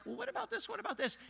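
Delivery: high, rapid curious asking; curious questions. Retold or imitated. Quizzing the neighbor, high and curious. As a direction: toss quick questions upward.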